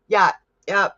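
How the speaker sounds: ordinary delivery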